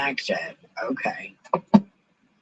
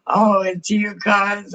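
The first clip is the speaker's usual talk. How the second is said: higher, louder fond repeat after a laugh; warm greeting; 5 semitones higher, 6 dB louder than usual. Real, not acted. Streamer greets cousin again, fondly. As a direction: say Oh, it's you again, gentler and fond.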